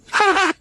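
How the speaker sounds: short, high gloating laugh; gleeful laugh. Acted. Claiming all the winnings, gleeful laugh.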